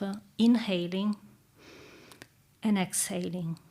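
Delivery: calm meditative tone. Acted. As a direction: guide them gently into stillness. Leads a guided reflection.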